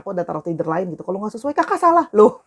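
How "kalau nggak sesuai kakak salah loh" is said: mock complaint. Reading commenters' complaint aloud.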